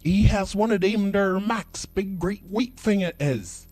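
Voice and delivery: high, loud, slow rustic customer voice; hammed-up high yokel. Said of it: "he said".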